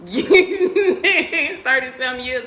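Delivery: slow, loud laughing delivery; laughing while talking. Real, not acted. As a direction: laugh through the punchline. Laughing punchline.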